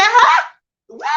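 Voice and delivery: high, loud startled question; hammed-up disbelief, voice shooting up; mock shock. Retelling own startled reaction.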